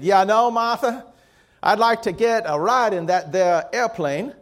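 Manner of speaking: a hint of a Southern old man wishing aloud; Southern accent. Old husband pleading with his wife, light Southern accent. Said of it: "my accent always goes to Southern".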